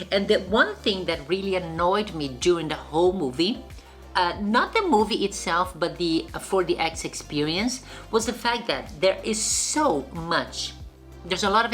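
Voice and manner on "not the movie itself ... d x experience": ordinary talk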